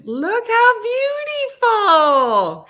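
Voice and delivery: high, loud drawn-out squeal; gushing admiration. Real, not acted. Showing off flowers from the garden, gushing. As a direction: stretch it out in loud, high delight.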